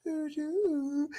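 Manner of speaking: put-on high, soft baby coo sounds; baby cooing imitation. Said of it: "we'll hear".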